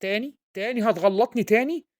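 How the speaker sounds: normal talking voice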